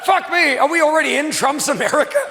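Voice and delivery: ordinary talk, nothing marked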